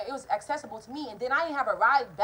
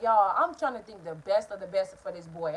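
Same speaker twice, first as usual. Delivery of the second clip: ordinary delivery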